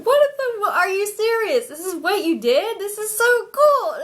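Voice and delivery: high, loud astonished squeal; excited squeal. Retold or imitated. Retelling meeting the angel, a high excited squeal. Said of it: "I was so excited".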